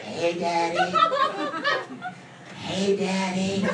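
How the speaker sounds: soft slow mock seductive voice; mock sultry whisper. Wife sneaking up to whisper in his ear, mock sultry. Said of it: "whispered in my ear".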